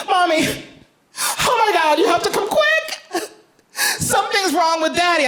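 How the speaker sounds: put-on high, urgent child voice; little girl voice. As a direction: play the panicked little daughter. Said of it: "She's like".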